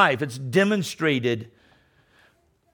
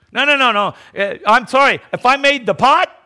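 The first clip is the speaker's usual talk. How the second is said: emphatic rebuttal; 8 semitones higher, 5 dB louder than usual. Real, not acted. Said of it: "preacher rebuts forcefully".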